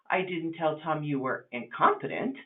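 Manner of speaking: "incompetent" set apart and stressed, rise at the end. Shown as a demonstration. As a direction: lean on "incompetent", implying a different word was said. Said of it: "I said something else".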